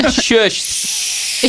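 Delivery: hissing shush like a snake. Shown as a demonstration. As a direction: hiss it out like a snake. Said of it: "sounds like a snake sissing".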